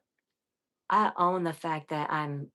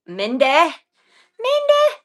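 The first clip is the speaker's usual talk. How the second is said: high, louder call of the name; 11 semitones higher, 12 dB louder than usual. Real, not acted. Streamer calls out to her sad viewer Minde, loud eager call.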